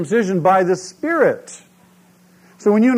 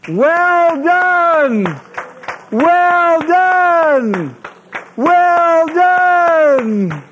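high, loud triumphant acclaim; heavenly host cheering; 10 semitones higher, 5 dB louder, much slower than usual. Pretend. Lord and heavenly host greeting the faithful with a jubilant shout. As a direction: cheer it like all of heaven rejoicing. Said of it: "Well, I thought it would sound good".

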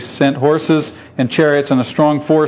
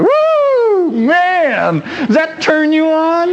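very high, joyful whoop; thrilled whoop; 12 semitones higher, much slower than usual. Real, not acted. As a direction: celebrate the miracle. Preacher thrilled by story.

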